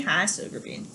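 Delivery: quick hushed hello to the dog; whispered aside. Real, not acted. Teacher whispers a quick hello to her dog. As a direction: whisper it without breaking the lecture.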